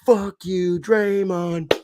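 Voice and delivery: put-on high, loud drunken jeer; drunk fan yell. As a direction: bellow it like a drunk Boston fan. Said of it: "the drunk ones start going".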